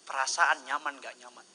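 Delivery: usual speaking voice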